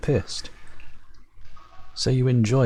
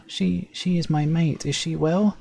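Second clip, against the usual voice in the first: hopeful Servile farmer; 8 semitones higher than usual. Acted.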